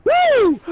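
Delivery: high mock-pained whoop; reenacted shock whoop. Friend reeling from the taser, high reenacted whoop. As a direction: let out a high whoop from the zap. Reenacts yelp after being tased.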